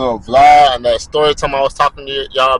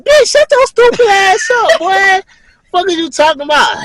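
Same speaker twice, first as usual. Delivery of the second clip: high, loud and slow, scolding her; mock furious yell; 7 dB louder than usual. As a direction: snap at the giggling woman from a high pitch. Yelling at the giggling woman.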